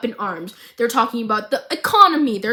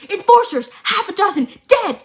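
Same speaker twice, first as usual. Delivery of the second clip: much higher and louder, spitting each word out; angry yell; 10 semitones higher, 7 dB louder than usual. Retold or imitated. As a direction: scold her with a mock fury. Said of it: "he starts yelling at her".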